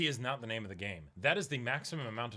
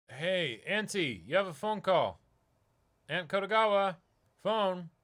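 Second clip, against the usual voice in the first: louder call across the shop; 5 semitones higher, 7 dB louder than usual. Acted. Nephew calling his aunt to the phone, calling out. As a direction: call out to someone in the back.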